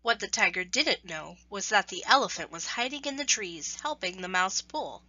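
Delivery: ordinary delivery, nothing marked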